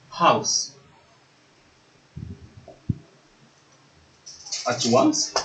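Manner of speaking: soft, isolated words with long pauses; word-by-word dictation. Real, not acted. Teacher writing the phrase on the board, slow word-by-word dictation. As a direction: say each word alone as you write it. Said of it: "J'écris ces trois phrases".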